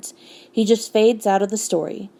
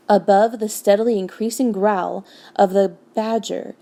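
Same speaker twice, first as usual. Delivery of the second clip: plain reading voice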